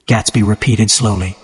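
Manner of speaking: ordinary delivery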